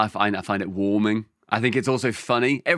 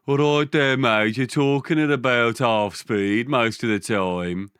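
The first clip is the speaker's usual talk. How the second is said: slow, dopey Birmingham drawl; mock Birmingham at half speed; dim Brummie accent; slower than usual. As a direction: sluggish Brummie impression. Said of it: "You sound fucking dim".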